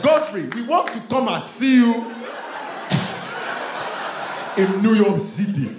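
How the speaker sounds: put-on high, loud Nigerian accent; excited cousins with Nigerian accents; Nigerian cousins' accent. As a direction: call out eagerly in a thick Nigerian accent. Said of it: "Not with that accent".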